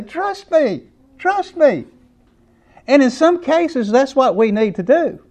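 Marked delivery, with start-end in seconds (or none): 0.0-1.9 much higher, earnest plea; pleading parent. Retold or imitated. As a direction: beg her to believe you, voice up high. Parent pleading with guilty child.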